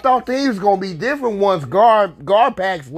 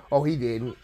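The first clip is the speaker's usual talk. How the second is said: deflated aside; 9 semitones lower, 8 dB quieter than usual. Real, not acted. Mock pleading, then deadpan answer.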